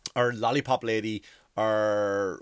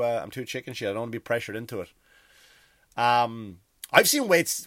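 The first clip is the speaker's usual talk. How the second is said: ordinary talk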